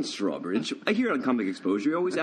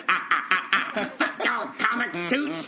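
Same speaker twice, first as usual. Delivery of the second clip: imitating cousin Toots; 7 semitones higher than usual. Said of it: "I can see him just sitting".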